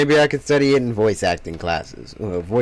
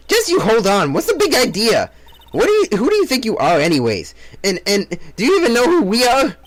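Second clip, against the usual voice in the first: indignant outrage; 8 semitones higher, 4 dB louder than usual. Acted. Indignant character confronts detectives.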